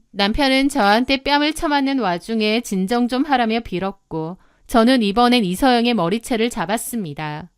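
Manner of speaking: ordinary delivery, nothing marked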